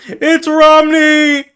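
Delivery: loud, high, drawn-out cheer; excited greeting. Real, not acted. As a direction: call out the arrival in a high squeal. Calls out a greeting to Romney.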